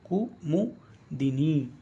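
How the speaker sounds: कुमुदिनी drawn out very slowly, each syllable clear; कुमुदिनी stretched out as a teacher spells it; slow syllable drill. Shown as a demonstration. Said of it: "यानी ये शुद्ध है".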